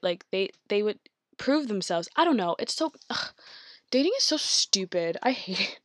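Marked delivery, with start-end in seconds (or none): 2.2-5.8 flustered exasperation. Real, not acted. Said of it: "Me getting flustered over here".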